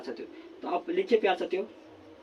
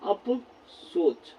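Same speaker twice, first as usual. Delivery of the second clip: slow pronunciation; 7 dB quieter, much slower than usual. Shown as a demonstration. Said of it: "बोलते हैं कि अफसोस".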